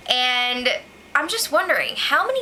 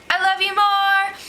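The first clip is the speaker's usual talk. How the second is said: mock yell; 7 semitones higher, 3 dB louder than usual. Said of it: "this guy yelled".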